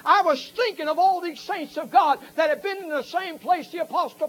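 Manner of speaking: high, loud revival preaching; shouted camp meeting sermon; fiery preaching. Acted. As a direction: preach it hard to the congregation. Preaching at full force.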